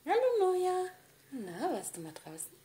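high loud squeal of a greeting; squeaky greeting. Real, not acted. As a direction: squeal hello at the pet. Greeting second pet excitedly.

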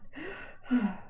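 put-on breathy old woman's gasp for air; wheezing gasp. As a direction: catch breath after a painful step. Old Elsie wheezing after step.

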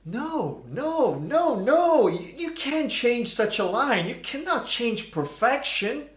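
high, rising no no no; horrified protest. Real, not acted. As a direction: plead against ruining a classic line. Protests the Friends dub line.

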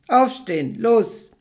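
loud barked command; guard yelling at the captive woman, loud; shouted order. Acted.

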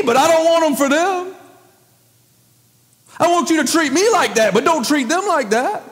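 preacher acting out a resentful prophet; Jonah yelling at God. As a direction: voice Jonah's bitter complaint to God. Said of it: "Man, he's yelling at God".

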